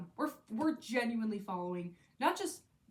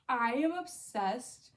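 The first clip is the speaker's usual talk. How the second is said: ordinary talk, nothing marked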